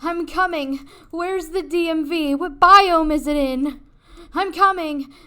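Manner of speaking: frantic play-acted shouting; heroic rescue shout. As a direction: yell it like you're charging to the rescue.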